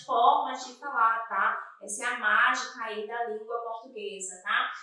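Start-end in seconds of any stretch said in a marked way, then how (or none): none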